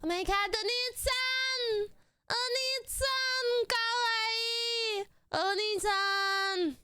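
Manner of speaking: high, squealing anime fangirl voice; excited anime fan. Pretend. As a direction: gush like a swooning anime fan. Squealing at an anime-style edit.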